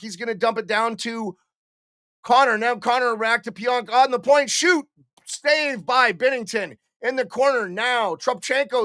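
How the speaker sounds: excited hockey play-by-play call; live play-by-play. Acted. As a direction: call the game like a radio announcer. Streamer calls the game live.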